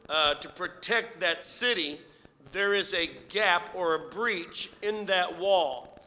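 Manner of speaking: rising preacher's fervor; preaching. Acted. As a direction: preach it with building force. Preaching builds in force.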